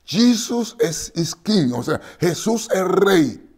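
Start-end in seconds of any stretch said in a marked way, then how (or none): 0.0-1.7 very high, loud, slow call of Jesus is king; shouted from the crowd. Retold or imitated. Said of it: "qué es lo que está gritando". 2.2-3.5 very high, loud, slow call of Jesus is king; shouted from the crowd. Retold or imitated. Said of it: "qué es lo que está gritando".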